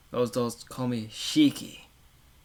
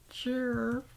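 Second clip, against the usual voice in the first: a single chirp pitched way up; high chirp; 12 semitones higher than usual. Pretend. Streamer trying a high cicada chirp. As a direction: squeak it like an insect.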